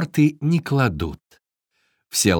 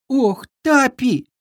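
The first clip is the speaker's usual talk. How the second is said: high-pitched, drawn-out wail; tearful complaining; 9 semitones higher, slower than usual. A narrator's reading. Fawn greets the Viking in tears, wailing. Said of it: "начал жаловаться оленёнок, заливаясь слезами".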